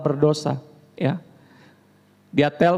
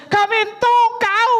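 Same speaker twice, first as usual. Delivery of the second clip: very high, loud, slow, drawn-out accusation; mock furious finger-pointing; angry jab; 4 dB louder, much slower than usual. Shown as a demonstration. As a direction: stretch "mentong" high and loud, pointing the words. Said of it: "Kita kan kalau marah sama orang".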